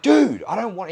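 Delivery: high, loud retold objection; acted fed-up outburst; exasperated protest. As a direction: push back at a nagging mate. Retelling his protest to pushy mate.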